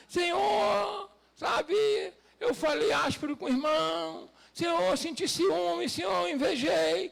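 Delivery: mild pleading as if owning up in prayer; acted confession. Believer confessing faults to God, acted pleading confession. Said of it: "Chegar pra Deus e falar".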